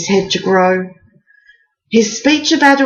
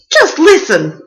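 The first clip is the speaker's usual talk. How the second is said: ordering; 6 semitones higher than usual. A narrator's reading. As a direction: command him to listen. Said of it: "ordered Tessa".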